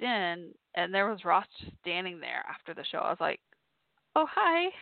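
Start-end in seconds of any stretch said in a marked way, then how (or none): 4.2-4.7 high, loud startled greeting; a sudden bright squeak of surprise; surprised awkward hi. Retold or imitated. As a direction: blurt it out, caught off guard. Surprised to find Ross there.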